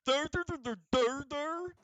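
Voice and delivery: high mocking goblin chant; silly high goblin dur-dur chant; imitating the goblin. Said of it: "What the fuck was that goblin back there?".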